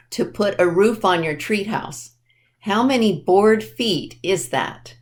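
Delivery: usual speaking voice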